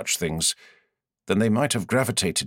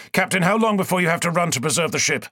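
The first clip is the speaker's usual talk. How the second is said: firm, raised voice of a commander giving orders; commanding lord; 8 semitones higher, faster than usual. A narrator's reading. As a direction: give the order like a lord on his ship. Lord demands.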